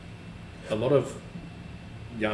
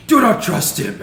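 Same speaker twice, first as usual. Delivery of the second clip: high, very loud echoed warning; hammed-up forceful yell; imitated yell; 12 semitones higher, 13 dB louder than usual. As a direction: shout it out as the possessed man. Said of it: "I'm gonna yell".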